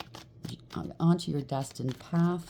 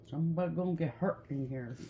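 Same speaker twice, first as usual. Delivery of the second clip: quiet, half-hearted attempt at a comedian's accent; Russell Peters; 7 dB quieter than usual. Quoting a comedian's saying mid card reading, half-hearted accent impression. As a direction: try the comedian's catchphrase accent, softly. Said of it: "that's one of his sayings".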